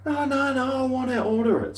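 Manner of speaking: very high mimicked voice; high put-on protest; imitated insistence. Imitates friend insisting on order.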